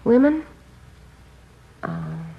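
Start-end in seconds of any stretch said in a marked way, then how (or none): 0.0-0.4 sudden higher, louder alarm; startled question. Acted. Sister startled by a detective's hint, sudden alarmed question. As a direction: blurt it out in shock. Sister guesses brother's trouble.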